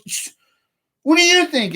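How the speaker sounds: very high, sneering imitation; mock furious homeowner. Homeowner hushing the process server, mock furious and high. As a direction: mimic him hushing, then snapping the question. Angry homeowner voiced in retelling.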